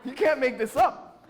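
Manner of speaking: loud, high, laughing through the words; real incredulous laughing. Real, not acted. Storyteller to audience after punchline, laughing in disbelief. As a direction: exclaim it to the crowd while cracking up.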